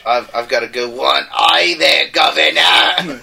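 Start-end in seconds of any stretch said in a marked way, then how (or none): 1.3-3.2 hammed-up Cockney greeting, slow and loud; a jokey British accent, laughing through it; mock British accent. Cast tryout: jokey British accent for Kingsman. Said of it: "judging just by our English accents".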